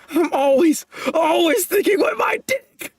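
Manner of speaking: high, wailing half-sob; mock-tearful whine. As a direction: whine it like a self-pitying fool. Reading the character's whiny line.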